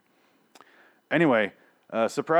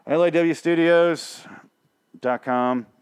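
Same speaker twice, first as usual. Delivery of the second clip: higher, louder, slow spelled-out plug; slow, emphatic announcer plug; 7 semitones higher, 6 dB louder, slower than usual. Real, not acted. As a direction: read it out like a station ident. Announcing the website.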